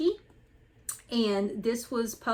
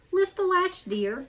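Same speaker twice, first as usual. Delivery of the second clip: grandmother's voice; 9 semitones higher, 4 dB louder, slower than usual. Acted. Grandmother invites the caller in.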